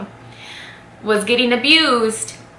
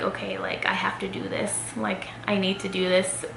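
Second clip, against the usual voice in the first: hushed whisper, steeling herself; whispered self-talk; 9 dB quieter than usual. Retold or imitated. Whispering to herself before walking out the door. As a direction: whisper it to yourself, working up courage.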